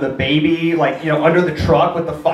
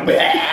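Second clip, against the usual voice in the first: mock drunken bleat; messy drunk "bleh"; drunken blurt. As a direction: blurt a wordless drunken noise. Drunk brother stumbles into room.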